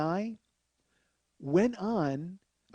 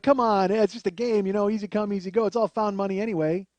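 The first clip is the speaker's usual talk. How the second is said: breezy shrug; 4 semitones higher, 6 dB louder than usual. Retold or imitated. Breezy captain shrugging off loss.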